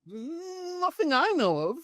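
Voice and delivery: very high, drawn-out noncommittal reply; feigned innocence. Retold or imitated. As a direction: play innocent, slow and far up in pitch. Feigns ignorance to British crew.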